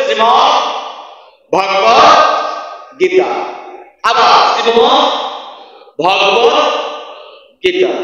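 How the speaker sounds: ভগবত word by word, rising, short first vowel; pronunciation drill. Shown as a demonstration. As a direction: model ভগবত for repeating, long pauses around it. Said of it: "উচ্চারণ কি হবে".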